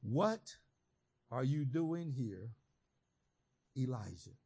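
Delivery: low, soft and slow, God asking gently. Acted. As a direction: let God ask it low and hushed. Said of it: "And God says to him again".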